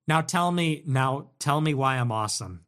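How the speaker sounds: ordinary delivery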